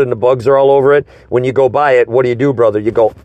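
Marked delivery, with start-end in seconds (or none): none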